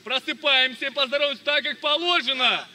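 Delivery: ordinary talk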